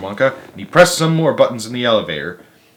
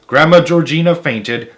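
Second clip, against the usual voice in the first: usual speaking voice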